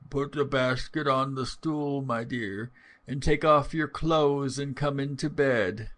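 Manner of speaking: lightly voiced feeble grandmother; wolf straining to sound frail; feeble put-on voice. Wolf luring the girl to bed, mock feeble voice. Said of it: "trying all he could to speak in a feeble voice".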